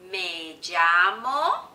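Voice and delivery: very slow and loud, rising at the end; drawn-out teaching demo, left hanging; slow model phrase. Shown as a demonstration. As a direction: stretch it out for learners to copy. Models phrase slowly for repetition.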